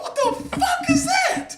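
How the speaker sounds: high, drawn-out shout of disbelief; slow, high-pitched alarmed yell; startled yell. Retold or imitated. Acts out startled reaction in story.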